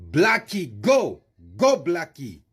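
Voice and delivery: mock South African accent, pitched high. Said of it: "I'm doing this with the South African accent".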